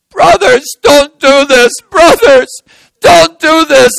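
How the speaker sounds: very high, loud desperate plea; crying out. Acted. Joseph begging brothers not to sell him, crying out. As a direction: beg at full voice. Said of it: "he cried".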